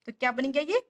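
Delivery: ordinary talk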